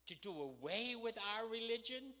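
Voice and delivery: high, loud accusing question; indignant challenge. Acted. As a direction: push the question up in mock outrage. Acted challenge from the foul-mouthed man.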